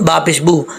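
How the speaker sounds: ordinary talk, nothing marked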